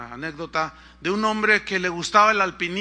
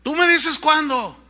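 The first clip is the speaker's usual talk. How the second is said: slow, high, loud holler; calling down; 8 semitones higher, 7 dB louder than usual. Retold or imitated. Rescuer waiting for man's signal, calling down. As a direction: call out slowly across a distance.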